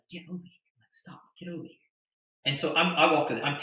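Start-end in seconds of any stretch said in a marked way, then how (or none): 0.1-1.7 much softer, pressing hiss of a summons; soft, urgent, beckoning; hushed urgent call. Retold or imitated. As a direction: call him over under your breath. Andrew urgently summoning him.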